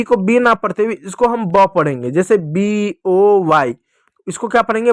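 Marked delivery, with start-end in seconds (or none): 2.4-3.7 slow, drawn-out letter names; spelled out slowly. Shown as a demonstration. Teacher spells out example word.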